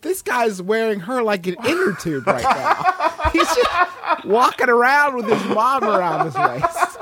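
slow, high-pitched words through laughter; laughing. Real, not acted. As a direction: crack up while describing it. Cracking up at absurd image.

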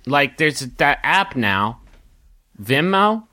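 ordinary delivery

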